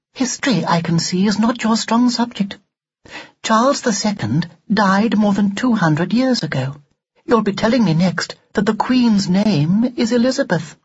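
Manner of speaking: high, superior doll voice; prim, scornful doll. Acted. Doll scorning Ned's grasp of history, loftily. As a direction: lecture the boy in high, precise tones.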